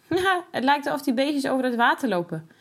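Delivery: put-on amused child laughing at ducklings. A narrator's reading. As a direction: giggle through the words. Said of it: "lacht Jasper".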